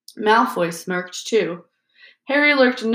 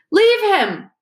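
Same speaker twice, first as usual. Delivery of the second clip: much higher, loud outburst; shouted; 12 semitones higher, 5 dB louder than usual. A narrator's reading. Harry shouts at the snake on impulse, high and loud. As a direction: yell without thinking. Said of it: "shouted stupidly at the snake".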